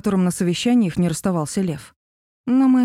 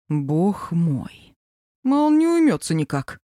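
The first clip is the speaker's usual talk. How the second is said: exasperated plea; slower than usual. Acted. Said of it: "взмолился Аркаша".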